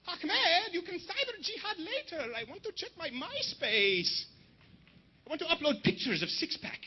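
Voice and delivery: put-on high, slow whining Middle Eastern accent; hammed-up Middle Eastern accent; mock Middle Eastern accent. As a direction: whine for the computer in a mock Arabic accent. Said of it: "the Taliban, they're enforcing cyber terrorism".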